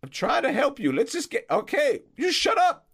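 put-on high, loud, distraught old man; fretting groundskeeper. Groundskeeper finding his friend Peng hurt, distraught high voice. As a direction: panic over your injured friend.

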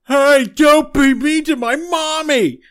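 slow, high and loud hurt plea; a huge monster-child defending his mother; big childlike creature. Acted. Blort protests at attackers of his mommy, slow, high, loud. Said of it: "and then Blort goes".

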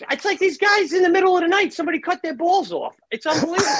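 high, fast, incredulous rant; exasperated rant. Real, not acted. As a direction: rail at them in a high, rising outburst. Ranting about spineless network executives.

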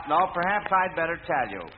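usual speaking voice

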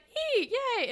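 imitating a high-pitched thrilled squeal; mock freak-out. Allie freaking out after a kiss, imitated giddy squeal. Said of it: "like, freaks out".

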